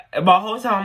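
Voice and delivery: ordinary talk, nothing marked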